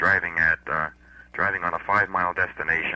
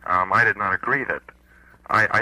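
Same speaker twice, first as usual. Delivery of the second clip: louder, making sure he's heard; repeating louder; 2 semitones higher, 4 dB louder than usual. Real, not acted. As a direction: push it down the phone line. After "hello?", caller repeats.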